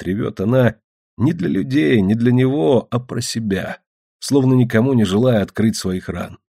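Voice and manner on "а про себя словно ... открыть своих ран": ordinary delivery, nothing marked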